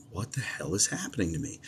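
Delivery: retold reaction, dropped to a near whisper; hushed disbelief. Man recounting hearing a strange voice in the shower, hushed disbelief. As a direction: whisper it as a bewildered thought to yourself.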